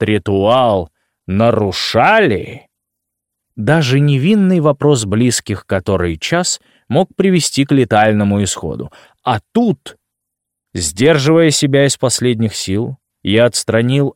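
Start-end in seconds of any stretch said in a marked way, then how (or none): none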